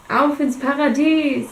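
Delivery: booming. A narrator's reading. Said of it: "höre ich Kane über meine Kopfhörer dröhnen".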